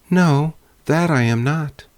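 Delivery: put-on high, bright girl's answer; brave girl. A narrator's reading. Girl answering the bear, high and brave.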